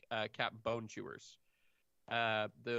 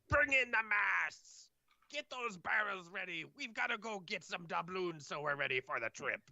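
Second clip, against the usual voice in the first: barked order; 8 semitones higher than usual. Acted. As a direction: bark orders across the deck. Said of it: "you can hear him barking to".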